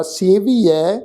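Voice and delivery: ਸੇਵੀਐ with drawn-out final "ai"; pronunciation demo. Shown as a demonstration. Teacher shows the vowel signs, ਸੇਵੀਐ with long final "ai". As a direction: say ਸੇਵੀਐ as "se-vee-aii". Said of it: "ਐੜੇ ਅੱਖਰ ਤੇ ਦੁਲਾਵਾਂ ਦੀ ਮਾਤਰਾ".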